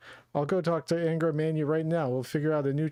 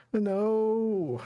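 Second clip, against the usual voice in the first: anguished no; 7 semitones higher than usual. Acted. Hospital patients dying scene.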